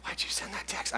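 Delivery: accusing whisper; hushed self-reproach; nagging inner voice. Acted. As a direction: whisper it like a thought in the head. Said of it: "Voice comes inside your head".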